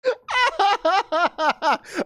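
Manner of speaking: high, helpless cackle of laughter; burst of high laughter. Real, not acted. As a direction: crack up completely. Cracks up at TTS.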